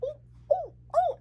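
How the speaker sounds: very high squealing ooh; giddy shipper squeal; excited squeals. Retold or imitated. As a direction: squeal with delight. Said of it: "I was like".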